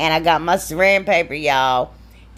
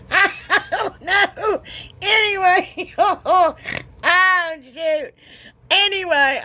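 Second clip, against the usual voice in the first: very high, giggling through the words; laughing; 12 semitones higher, slower than usual. Real, not acted. Crafter losing it over her repeated demonstration, laughing. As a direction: try to carry on while cracking up. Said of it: "I can't stop laughing".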